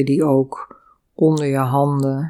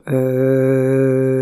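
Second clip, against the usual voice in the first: long, steady eu on one note; sustained eu sound; 3 dB louder than usual. Shown as a demonstration. Yoga teacher demonstrates the exhale sound, long sustained eu. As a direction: hold the vowel through the whole out-breath. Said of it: "Je maakt ook steeds de klank".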